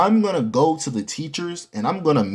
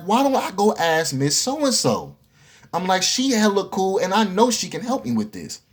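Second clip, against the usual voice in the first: excited realization; 7 semitones higher than usual. Retold or imitated. Retold sudden idea.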